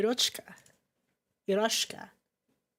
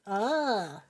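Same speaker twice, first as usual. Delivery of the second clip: ordinary talk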